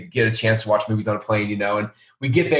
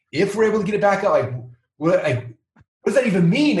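higher, disbelieving repeat of "if"; incredulous; 9 semitones higher than usual. Retold or imitated. Repeating the partner's words in disbelief, incredulous. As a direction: echo the word like you can't believe it. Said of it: "I was just like".

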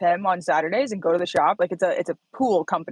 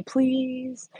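put-on pleading little girl; 7 semitones higher than usual. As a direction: plead sweetly like a toddler. Voicing her three-year-old daughter's advice.

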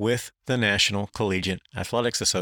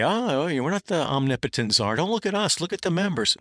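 mock protest, pitched up high; higher-pitched, put-on innocent denial; Mark Emmert and Miles Brand; 6 semitones higher than usual. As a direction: imitate officials disclaiming all power. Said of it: "So when Mark Emmert and Miles Brand say".

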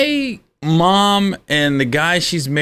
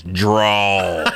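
cowboy; 6 semitones lower than usual. Retold or imitated. Cowboy duel in retold story.

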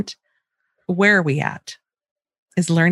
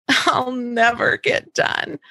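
slow, whining daughter's complaint; whiny child; 4 semitones higher, much slower than usual. Retold or imitated. Daughter complaining about schoolwork, slow and whiny. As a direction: drag it out like a sulky kid. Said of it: "actual things that she said to me".

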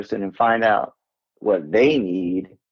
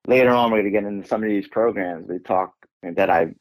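ordinary talk

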